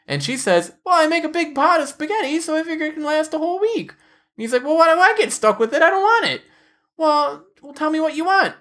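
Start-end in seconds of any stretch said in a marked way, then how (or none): 0.8-3.9 very high mock-wife voice; high, matter-of-fact caricature of a wife; imitated wife. Voicing wife in retold quarrel. 4.6-6.4 high, whining husband complaint; high-pitched imitated griping; mock protest. Husband protesting in retold quarrel. 6.9-8.5 high-pitched exasperated wife; high mock-wife retort; imitated wife. Voicing wife in retold quarrel.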